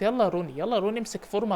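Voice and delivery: normal talking voice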